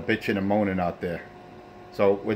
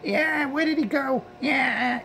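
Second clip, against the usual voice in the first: whiny high falsetto, mocking; mock crybaby; much slower than usual. Driver taunting the crying guys on the radio, whiny falsetto. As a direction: squeak it like a sniveling crybaby. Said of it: "All I hear is you and Pedro crying".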